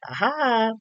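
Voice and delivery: a high eureka aha; playing a therapist who spots the issue, high; mock therapist aha. Imitating a therapist cracking the case, high aha. They're like.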